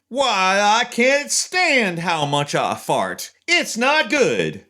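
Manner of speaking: high, drawn-out hammy lament; over the top. Shown as a demonstration. Demonstrating a voice changer on a farting line, hammed-up high wail. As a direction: wail it theatrically, stretching the words. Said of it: "maybe a little over the top".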